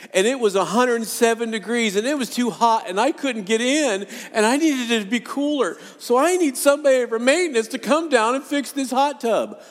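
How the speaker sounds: high, loud mock whine about the hot tub; mock whiny complaint. Pastor mocks his own vacation complaint, high whiny and loud. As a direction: ham up a spoiled guest's whine.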